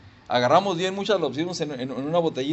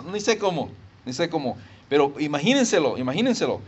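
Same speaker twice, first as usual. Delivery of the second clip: ordinary talk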